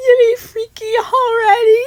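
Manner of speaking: high, loud, laughing through the words; laughing quote. Retold or imitated. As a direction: tease him while giggling. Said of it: "So then I was like".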